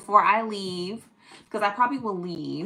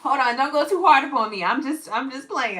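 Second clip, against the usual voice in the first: ordinary talk, nothing marked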